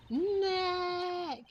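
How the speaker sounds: a high call of the name. Acted. Trying to trigger the camera, a high call. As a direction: call out Nick to set off the camera.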